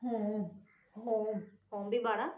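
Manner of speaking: "होम" with a full heavy h; heavy chest h. Shown as a demonstration. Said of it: "बड़ी आवाज़ में".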